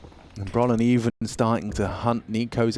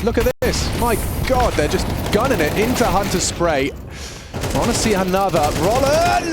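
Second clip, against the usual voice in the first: excited call; 7 semitones higher than usual. Real, not acted. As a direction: ride the wave of a fast play. Caster hyped by fast play.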